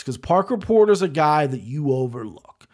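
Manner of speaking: normal talking voice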